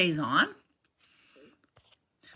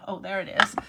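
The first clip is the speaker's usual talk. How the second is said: normal talking voice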